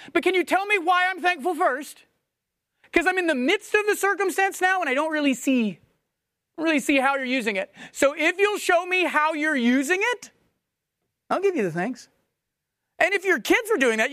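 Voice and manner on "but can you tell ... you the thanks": higher, sulky bargaining voice; a lightly voiced whiny teen; mock teenage bargaining. Teen haggling with God over thanks, whiny.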